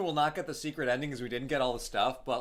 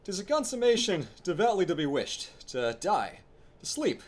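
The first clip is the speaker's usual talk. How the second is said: lightly theatrical Hamlet recital; a hint of a brooding prince; Shakespeare monologue; 6 semitones higher than usual. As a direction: declaim it like a stage Hamlet, pausing.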